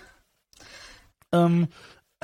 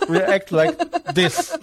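laughing; much slower than usual. Real, not acted. Laughing high voice.

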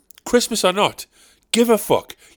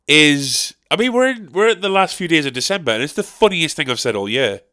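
ordinary talk

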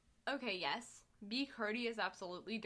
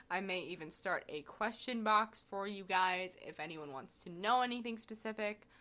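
ordinary talk, nothing marked

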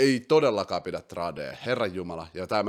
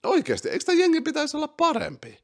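high, fast disbelieving question; can't believe it, squeak it up high; incredulous; faster than usual. Real, not acted. Host baffled by the Timberwolves, high incredulous question.

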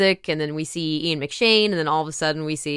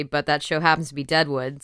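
ordinary talk

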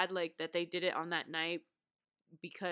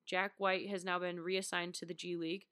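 normal talking voice

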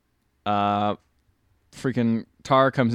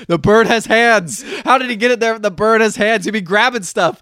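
lightly mimicked worked-up friend, higher voice; mock panic, pitched up; 9 semitones higher than usual. Teasing friend about a bird with hands, mock alarm. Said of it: "You're like".

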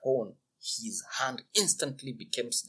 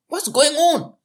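much higher, louder curious question; curious call; 13 dB louder than usual. Acted. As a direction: call out to a stranger. Simpleton asks the crowd.

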